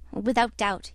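ordinary delivery, nothing marked

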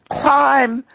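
high, loud single command; urgent shout. A narrator's reading. Freckles shouting at the lobbyist to climb out. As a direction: bark the order to climb.

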